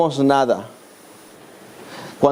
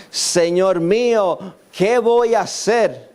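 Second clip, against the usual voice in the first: desperate plea; 3 semitones higher, slower than usual. Acted. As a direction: plead to God in mild distress. Voicing own desperate prayer.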